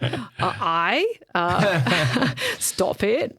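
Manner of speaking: put-on son's bashful Scottish accent dissolving into a laugh; imitating son's Scottish attempt. Mum imitating her son fumbling a Scottish accent, giggling. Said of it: "So talk Scottish".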